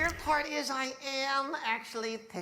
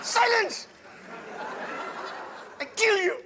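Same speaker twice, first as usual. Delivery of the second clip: Achmed shout; 10 semitones higher than usual. Retold or imitated. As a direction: shout it like the skeleton puppet. Achmed catchphrase again.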